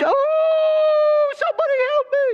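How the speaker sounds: high, loud desperate cry; tiny trapped man shrieking for rescue; crying out for help. Acted. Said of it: "he's crying out".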